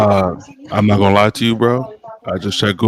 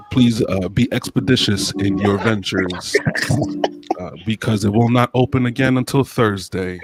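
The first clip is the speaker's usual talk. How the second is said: formal announcement. Acted. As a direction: read it like a church bulletin. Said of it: "it's an announcement".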